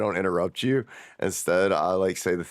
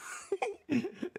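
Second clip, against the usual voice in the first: sudden shrill laugh burst; high squealing laugh; 10 dB quieter than usual. Real, not acted. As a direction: crack up high. Laughs mid-sentence.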